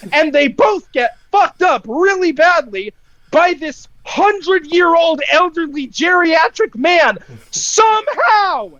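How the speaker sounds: normal talking voice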